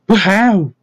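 high-pitched, astonished stammer; faint with shock. A narrator's reading. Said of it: "Henry looked faint".

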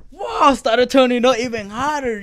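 very high, loud whoop into excited telling; retold hype. Recalling a stranger going crazy to the song, retold hype. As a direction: re-enact a hyped-up shout, pitch way up. Retells wild crowd reaction.

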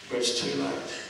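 soft, breathy repeat; hushed ominous echo; whispered echo. Acted. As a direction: let it fall to a whisper. Repeated warning, echoed softly.

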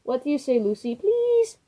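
high, loud pleading little train; excited begging. A narrator's reading. Youngest train begs the older trains for a party, high and loud. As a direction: beg like an overexcited child. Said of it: "Choo Choo Charlie begged".

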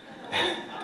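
a high, soft burst of laughter; real laugh. Real, not acted. The father laughing at his daughter's complaint, high and soft.

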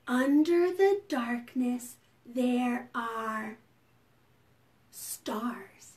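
slow, softening recitation; hushed, lilting verse; bedtime rhyme. Pretend. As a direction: lead children gently through a rhyme with pauses.

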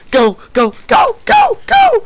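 very high, very loud squealing cheers; frantic hyped cheering; excited shrieks. Real, not acted. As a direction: shriek it at the top of your range. Cheering on rescuer in game.

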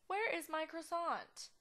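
slow, high, loud outrage; melodramatic demand. Pretend. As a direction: demand it one word at a time. Slower louder repeat of the question.

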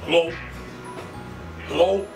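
normal talking voice

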